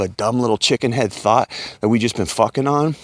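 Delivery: ordinary delivery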